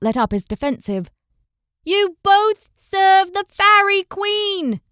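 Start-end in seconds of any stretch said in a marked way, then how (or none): 1.8-4.8 slow, high, loud shout; yelling even louder. A narrator's reading. Una shouting to stop the knights fighting. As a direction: bellow every word to be heard. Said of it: "Una yelled even louder".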